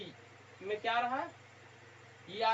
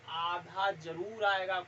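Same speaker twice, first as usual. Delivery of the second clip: slow emphasis; 3 semitones lower, much slower than usual. Shown as a demonstration. Teacher stresses the key rule.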